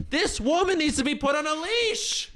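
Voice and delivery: high-pitched exasperated outburst; outraged rant. Real, not acted. Ranting about the screaming woman.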